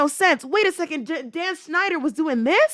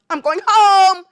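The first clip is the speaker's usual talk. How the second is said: louder, higher, firm; firm announcement; 5 semitones higher, 6 dB louder, slower than usual. Retold or imitated. Telling the kids she's leaving, louder and firm. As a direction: declare it to the kids. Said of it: "And then I'm like".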